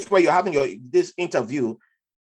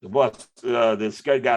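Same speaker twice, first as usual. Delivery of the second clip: ordinary talk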